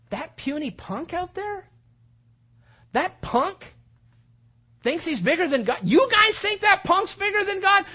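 scornful, high-pitched, building to a shout; indignant disbelief. Acted. Young shepherd scorning the giant before his brothers, indignant and building. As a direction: start incredulous, end in an outraged yell.

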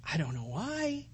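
ordinary delivery

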